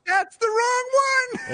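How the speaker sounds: high slow squealing shout. Pretend. Mocking echo, shouted high.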